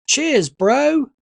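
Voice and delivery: high, loud cheery thanks. A narrator's reading. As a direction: toss a grinning cheers at your brother. Boy thanks brother.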